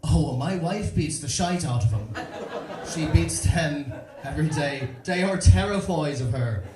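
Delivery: half-laughing Irish dad admitting fear of his wife; a touch of Irish, laughing aside; Irish cousin confiding. Retold or imitated. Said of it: "leans in close and goes".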